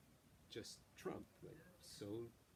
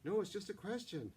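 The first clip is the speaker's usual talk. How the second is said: flustered denial; 9 semitones higher, 6 dB louder than usual. Acted. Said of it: "Mara answers a flustered Forrest".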